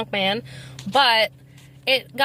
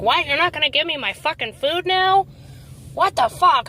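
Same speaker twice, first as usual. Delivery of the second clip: raised, higher-pitched mock outrage; mimicked drunk yell; 6 semitones higher than usual. Retelling the drunk customer berating the cashier, mock angry yell. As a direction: imitate a drunk man's indignant yelling at the counter.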